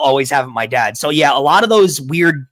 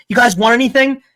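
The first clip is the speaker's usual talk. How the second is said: higher, breezy fake offer; 9 semitones higher than usual. Uncle heading to the bathroom, faking a polite offer. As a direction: ask like you hope they say no. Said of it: "the fake, um".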